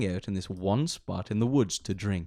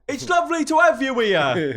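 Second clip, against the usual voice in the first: put-on voice; 13 semitones higher, 6 dB louder than usual. Podcast intro, high put-on voice.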